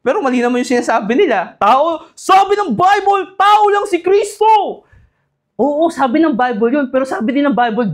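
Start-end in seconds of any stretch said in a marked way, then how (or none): 1.6-4.8 high, loud and slow declaiming; mock dynamic preacher. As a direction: proclaim it with overdone fervour. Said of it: "ganun sila mag-preach".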